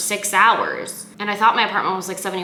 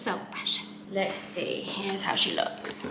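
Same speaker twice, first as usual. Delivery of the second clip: whisper; 9 dB quieter, slower than usual. Real, not acted. Showing the bread to camera.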